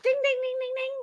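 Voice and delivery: high sing-song bell chime; playful high ding-ding; mock game-show ding. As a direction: ring it out like a quiz buzzer, mocking. Mocking letter as game-show bell.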